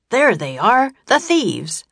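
shouting guard. A narrator's reading. Sleepy guard spotting thieves for his partner, shouted. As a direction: raise the alarm at full voice. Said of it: "the sleepy guard shouted".

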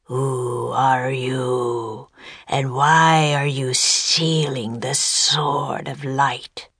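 very slow, low monster voice; deep serpent voice. A narrator's reading. Sea serpent challenging two children, slow deep voice. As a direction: drag out each word like an ancient serpent. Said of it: "the sea serpent's deep voice boomed".

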